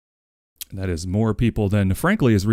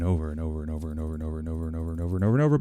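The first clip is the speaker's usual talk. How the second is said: rapid-fire repetition; 5 semitones lower, 6 dB quieter, much faster than usual. Acted. Rattling off repeats very fast.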